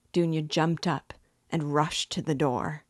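plain reading voice

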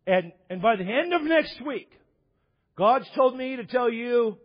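high, emphatic mock prophecy; imitated televangelist. Televangelist promising a widow a return, hammed-up and high. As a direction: imitate a TV preacher delivering a promise. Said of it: "He goes".

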